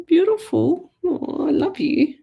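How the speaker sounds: high cooing pet talk. Real, not acted. Cooing to the cat.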